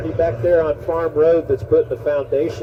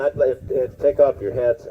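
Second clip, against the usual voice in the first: ordinary talk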